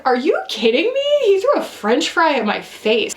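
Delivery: high, incredulous retold outburst; mock disbelief. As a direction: replay your own shock, pitched high. Retelling toddler throwing food.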